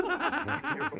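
giggling through it; laughing. Real, not acted. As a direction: say it while laughing. Laughing repeated joke.

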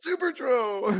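high, loud burst of delight; excited exclamation. Real, not acted. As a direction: cry it out like a big reveal. Echoing the super troll reveal.

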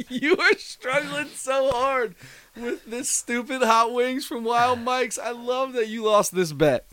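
high, slow laughing speech. Real, not acted. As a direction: talk while cracking up. Laughing at friend eating hot wings.

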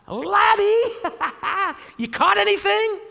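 put-on high, laughing call to the boys; Jesus teasing the tired fishermen with a laugh; playful teasing. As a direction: tease them like kids. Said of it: "that word friends in the Greek means little boy".

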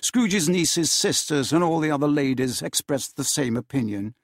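ordinary delivery

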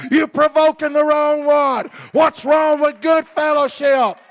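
high, loud shouted preaching; preaching at full force. Acted. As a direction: hammer the congregation with it.

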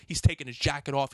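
normal talking voice

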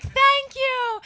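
a bright falsetto thank you, delighted; squeaky thank you. Retold or imitated. Waitress thanking her boss in a high squeak. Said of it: "I was like".

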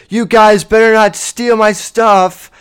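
a hammed-up feeble warning through the speaker; mocking a weak threat. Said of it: "heard a voice that was like".

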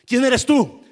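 loud, high "who are you?"; startled demand. Retold or imitated. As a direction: challenge the stranger who jumped in. Strangers in car question him. (pregunta fuerte y sorprendida)

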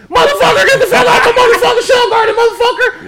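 hammed-up furious shriek, very high and loud; going at one hundred. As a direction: explode from zero into a mock screaming rant. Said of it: "did you come out at one hundred?".

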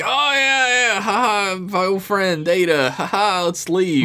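high, hammed-up forced chuckle to cover; awkward fake laughter to change the subject; mock nervous laugh. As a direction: laugh it off and hurry away. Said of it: "He's like".

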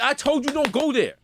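high-pitched mock told-you-so; exasperated scolding. Player scolding the teammate who got shot, exasperated. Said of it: "I was like".

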